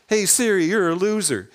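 mock yell from the back seat; retold yell. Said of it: "he yells out".

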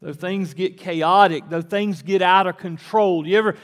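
loud, high, rising sermon climax; preacher driving home that God does not change; fiery preaching. Acted. Preacher to congregation, loud and high.

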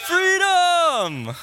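rallying cry building to a high shout; William Wallace. Acted. Preacher performing Braveheart's famous speech, building to a roar. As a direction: build up, then bellow the last word long and high. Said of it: "The epic line of William Wallace saying".

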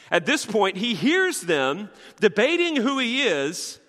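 usual speaking voice

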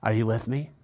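ordinary talk, nothing marked

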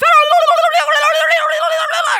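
high, loud rapid babble; rolling wordless gibberish at full tilt; imitated frenzy of tongues; mock speaking in tongues. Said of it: "Everybody speak in tongues".

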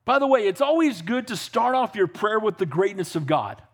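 ordinary talk, nothing marked

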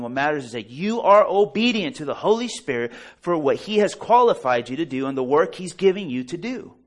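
high, loud building sermon climax; full force preaching. Acted. Preacher rousing the congregation, loud and high. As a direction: preach it out, rising and ringing.

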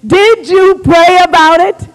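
loud, high, slow accusing question; agitated demand. Retold or imitated. Husband checking on wife two weeks later, agitated demand. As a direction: stretch each word in mock exasperation. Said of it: "he's a little agitated with me".

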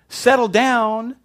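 high, drawn-out pleading; what Jesus didn't say, sung out high and slow; mock gentle plea. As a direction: coax the wind like a child.

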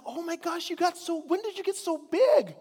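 high, delighted voice rising to a squeal; amazed greeting. Retold or imitated. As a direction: marvel at how big the child got. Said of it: "you're like".

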